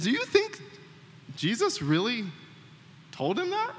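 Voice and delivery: high, wondering retold question; coworker's wondering question. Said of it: "the last thing I hear is".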